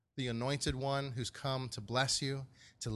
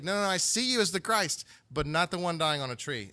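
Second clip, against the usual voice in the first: higher, louder insistent objection; pitched-up, pushy denial; acted protest; 5 semitones higher, 5 dB louder than usual. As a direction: play a disciple refusing to hear it. Acts out Peter protesting to Jesus.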